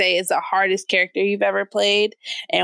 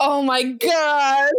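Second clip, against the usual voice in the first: laughing; 6 semitones higher, much slower than usual. Real, not acted. Laughing disbelief.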